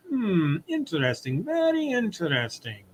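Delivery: a German doctor pondering aloud; German accent. Acted. Doctor examining Lois, German accent. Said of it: "I gave him a German accent".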